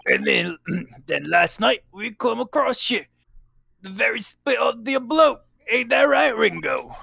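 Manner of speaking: high-pitched Cockney tale-telling; Cockney crook. Acted. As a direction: recount it as a high-voiced Cockney villain. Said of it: "they are Cockney".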